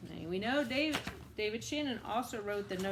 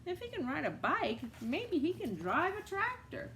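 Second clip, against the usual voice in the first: lightly voiced, higher and unsure; high doubtful animal voices; 8 semitones higher than usual. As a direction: raise the pitch, doubtful chorus. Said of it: "they all said".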